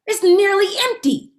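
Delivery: high, loud outburst; alarmed. Acted. Mother discovering the empty syrup bottle, high and loud. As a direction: blurt it in alarm.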